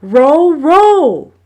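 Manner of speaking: high, loud chant-like call of row. A narrator's reading. Maui calling the rowing beat to his brothers, loud. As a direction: drive the rhythm of the oars.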